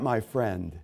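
usual speaking voice

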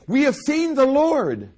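excited proclamation. Acted. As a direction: proclaim it like great news. Disciples' joyful proclamation.

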